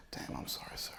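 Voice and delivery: very soft, awkward condolence; hushed sympathy. Retold or imitated. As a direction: murmur an apology to a stranger. Retold sympathy after bad news.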